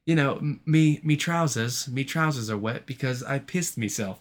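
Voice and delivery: slow, put-on British voice; a lightly hammed-up British bloke; a hint of a cartoon British accent; mock British accent. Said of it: "proper English".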